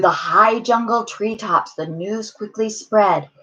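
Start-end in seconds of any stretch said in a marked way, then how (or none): none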